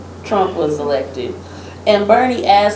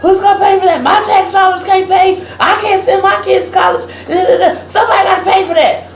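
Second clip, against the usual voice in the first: indignant conservative lady; 9 semitones higher, faster than usual. Retold or imitated. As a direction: mimic an offended taxpayer demanding who pays. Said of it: "the lady was like".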